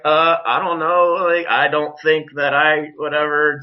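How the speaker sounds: mock stammering. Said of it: "just stammering, stuttering like an idiot".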